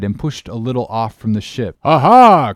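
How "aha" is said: high, loud exclamation of discovery; a triumphant shout from the rigging; sudden excited cry. Acted. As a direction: catch sight of something and cry out. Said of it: "cried Dago all at once".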